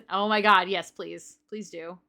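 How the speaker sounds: usual speaking voice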